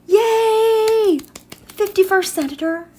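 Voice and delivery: a high, bursting yay of delight; excited cheer. Real, not acted. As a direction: cheer the news like a small victory. Celebrates election result on live.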